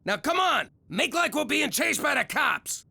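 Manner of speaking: excited urging. Acted. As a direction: fire him up to floor it. Urging son to speed.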